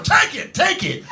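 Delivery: high-pitched frantic urging; urgent, high mock cry; frantic toothless woman. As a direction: play a woman running out, voice jumping way up. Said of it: "this fucking woman of no teeth comes running out of a house going".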